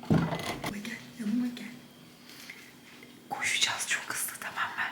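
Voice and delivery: hushed whisper; whispering while hiding. Real, not acted. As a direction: whisper so dad won't hear. (saklanırken fısıltı)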